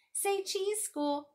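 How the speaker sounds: high, loud playful call; bright sing-out in a high child voice; child playing photographer. A narrator's reading. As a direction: call out to pose the subject.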